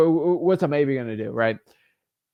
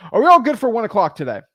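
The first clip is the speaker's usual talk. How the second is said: higher, louder friendly check-in; peppy sales-call question; upbeat phone confirmation; 7 semitones higher, 7 dB louder than usual. Acted. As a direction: confirm brightly with the client. Acted confirmation call to seller.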